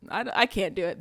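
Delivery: ordinary delivery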